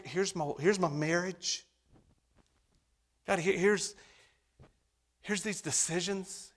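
pleading prayer. Acted. Modelled prayer for congregation.

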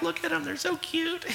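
high, soft cooing over a grandkid; doting grandparent. Retold or imitated. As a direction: coo like a smitten grandparent. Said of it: "but you're like".

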